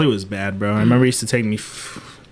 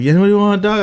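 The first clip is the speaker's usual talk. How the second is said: higher, louder hawking a dog; pushy seller; 9 semitones higher, 4 dB louder, much faster than usual. Retold or imitated. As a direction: sell it hard, loud and high. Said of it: "He was like".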